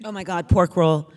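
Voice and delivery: ordinary talk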